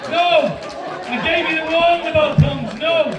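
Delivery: loud, high telling-off of a dog; scolding shout. Acted. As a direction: yell it like a cross owner.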